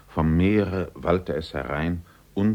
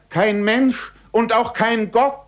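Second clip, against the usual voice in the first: very high, loud cry rising at the end; despairing scream; 9 dB louder than usual. A narrator's reading. Lost man screaming that no god is here. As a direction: scream your loneliness to the sky. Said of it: "schrie er wieder".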